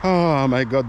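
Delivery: ordinary talk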